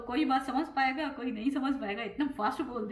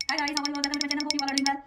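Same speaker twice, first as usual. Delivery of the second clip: rattled-off, higher-pitched channel welcome; fast talking; 4 semitones higher, 3 dB louder than usual. Shown as a demonstration. Vlogger showing her usual welcome to viewers, fast talking. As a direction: race through the greeting at top speed. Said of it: "अगर मैं fast भी नहीं बोलूँगी तो कैसे बोलूँगी".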